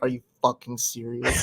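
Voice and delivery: low, soft deadpan disbelief; muttered disbelief. Retold or imitated. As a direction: grumble it with your head down, fed up. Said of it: "you see me with my head down, like".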